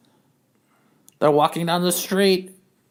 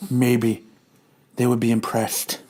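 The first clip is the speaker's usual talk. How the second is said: low, slightly slower; a hint of a big man's voice; lightly voiced low character; 9 semitones lower, 4 dB quieter, slower than usual. Character explains costume sizes.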